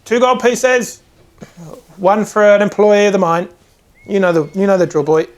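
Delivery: dwarf smith naming the price; dwarf smith quoting price. Acted. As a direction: state the price firmly.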